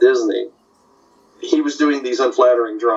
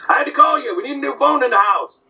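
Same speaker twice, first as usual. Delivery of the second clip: Rochester voice=145; 10 semitones higher than usual. Retold or imitated. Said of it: "doing Benny and Rochester".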